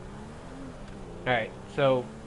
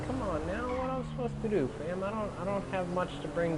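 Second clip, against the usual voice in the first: rising incredulous whine; exasperated; 7 semitones higher, 4 dB quieter than usual. Real, not acted. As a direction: plead helplessly. Exasperated complaint.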